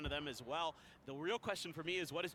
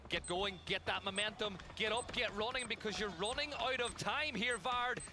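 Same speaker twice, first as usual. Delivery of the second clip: urgent; 4 semitones higher than usual. Real, not acted. Caster urges player on.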